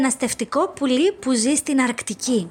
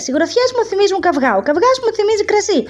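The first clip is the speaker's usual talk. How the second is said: fast, high, speeding up chain of thoughts; boy rattling off; 6 semitones higher, 3 dB louder, faster than usual. Acted. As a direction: reel it off faster and faster. Boy's chain of associations. (παιδί τα λέει όλο και πιο γρήγορα)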